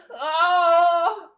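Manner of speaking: loud, high startled yell; shocked cry. Real, not acted. Gamer yelling at the screen in shock. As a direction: cry out as if caught off guard.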